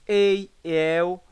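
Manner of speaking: "ei, el" with open vowels; open diphthongs. Shown as a demonstration. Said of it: "com ditongos abertos". ("ei, el" como ditongos abertos)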